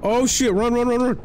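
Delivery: panicked high yelling; screaming while running away; being chased. Real, not acted. Fleeing the Bracken in panic.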